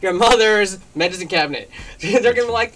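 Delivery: ordinary talk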